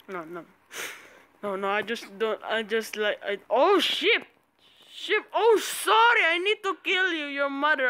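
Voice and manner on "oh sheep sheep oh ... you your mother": high excited shouting. Real, not acted. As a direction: squeal over the sheep. Thrilled by sheep, mock apology.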